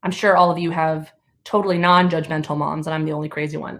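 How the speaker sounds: usual speaking voice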